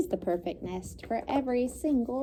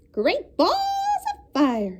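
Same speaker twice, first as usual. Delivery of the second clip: much higher, slow Southern-style goose; goose exclaiming; 13 semitones higher, 4 dB louder, much slower than usual. A narrator's reading. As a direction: drawl it like a brassy goose. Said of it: "she cried".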